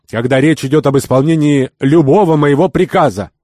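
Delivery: very high, loud outburst; heated, shouted indignation; fuming. A narrator's reading. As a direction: rage as a master disobeyed. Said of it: "кипятился Сафар".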